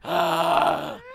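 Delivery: loud sucked-in snorting gasp; inhaled creature call. Shown as a demonstration. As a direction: pull a creature's cry in through the throat. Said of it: "I can start here like a".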